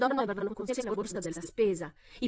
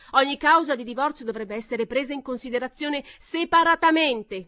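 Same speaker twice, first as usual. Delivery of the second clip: loud, higher shout; 5 semitones higher, 8 dB louder than usual. A narrator's reading. Another voice shouting at Pamela. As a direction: shout it over the din. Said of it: "gridò un'altra voce".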